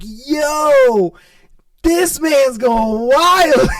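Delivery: hyped up, cracking up at the scene; excited disbelief. Retold or imitated. Friend whispering in the corner, loud and thrilled.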